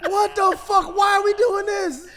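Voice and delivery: high, loud incredulous outburst; exasperated shout. Real, not acted. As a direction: shout in amused disbelief. Exclaiming amid group hysterics.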